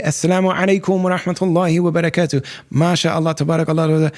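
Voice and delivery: playful imitation of devout Arabic phrasing; Arabic-style phrases. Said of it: "that's the way that a lot of Muslims talk".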